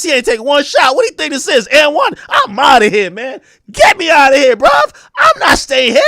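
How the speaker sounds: loud, heated imitation of a player ranting; shouting mock player complaint; mock angry rant. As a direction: rant like a fed-up star demanding a trade.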